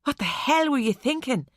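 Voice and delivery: put-on light Irish accent, reproachful; Irish accent, rebuking. A narrator's reading. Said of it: "his Irish accent strong".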